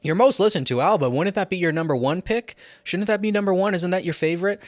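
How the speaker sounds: imagined viewer. Pretend. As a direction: play a doubtful fan asking why it isn't number one. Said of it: "you might be thinking".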